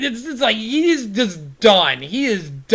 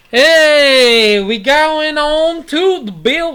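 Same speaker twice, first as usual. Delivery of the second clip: louder, higher Southern drawl on hey; Southern accent; 6 semitones higher, 5 dB louder, slower than usual. Real, not acted. Host moving on to the Bills, in a Southern accent. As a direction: slip into a Southern accent to announce the next game. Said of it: "Why did I talk in the Southern accent?".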